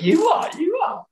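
very high mock-mate squeal; teasing mate. Mate ribs him for chewing nothing, high mocking voice. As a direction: imitate a teasing mate in falsetto. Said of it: "And he went".

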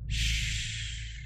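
breathy shush, barely there; hushing whisper. Acted. Clown hushes trapped Bill so others won't hear, whispered. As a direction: hush him with a sharp whispered shh.

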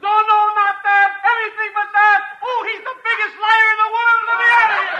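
loud, high, frantic protest; panicked shout. Acted. Niles protesting to Abbott and Costello, loud and frantic. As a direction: beg to be let out, shouting.

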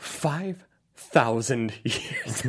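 very slow, drawn-out mock-villain delivery; spelled-out villain line. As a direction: stretch every word with exaggerated portent. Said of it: "They really spell out".

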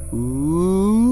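high hooting ghost wail; a rising mock ghost moan; ghost ooh. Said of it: "Your ghost impression needs work".

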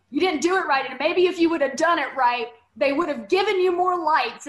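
imitating a scolding commenter; mock scolding. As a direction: throw his criticism back, hammed up and loud. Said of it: "this dude comes on and he's like".

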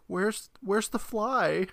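put-on high, slow child's question; imitated son. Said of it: "my son was like".

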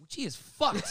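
high, yelled disbelief; shocked exclamation. Retold or imitated. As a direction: shout it out, stunned. Seeing a tripping guest.

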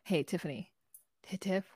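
coaxing wake-up call. Retold or imitated. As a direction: coax a sleeping friend awake softly. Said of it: "the girls come in and they're like".

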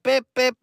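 high mock honk; imitated car horn. Friends honk outside, high imitated horn beeps. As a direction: beep it like a horn. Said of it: "They're outside".

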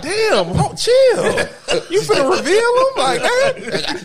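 high shocked shout. Retold or imitated. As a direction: yell it in disbelief. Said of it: "Everybody like".

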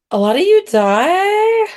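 high, slow and ominous; high sing-song doom. Acted. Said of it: "ominous vision reveal".

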